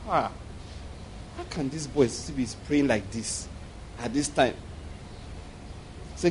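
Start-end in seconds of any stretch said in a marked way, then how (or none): 0.1-4.5 God voiced quietly. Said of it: "And he says".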